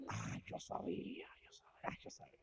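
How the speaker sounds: fading to a whisper; smug whisper. Acted. Wesley whispering his smug satisfaction to himself. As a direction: whisper it to yourself, pleased.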